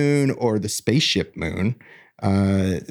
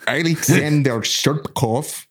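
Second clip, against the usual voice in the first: put-on thick Russian accent on the name; 5 semitones higher than usual. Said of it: "More accent".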